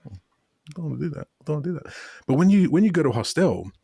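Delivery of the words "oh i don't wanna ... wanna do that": softer, higher, timid self-mockery; mock-shy whine, much softer; shy mumble. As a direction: shrink away from talking to a girl. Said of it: "I'm like".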